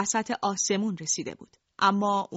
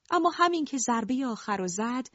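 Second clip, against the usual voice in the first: ordinary delivery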